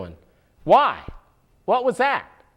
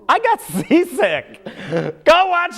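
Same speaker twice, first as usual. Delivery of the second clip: laughing; 8 semitones higher than usual. Real, not acted. Laughing at shaky camera.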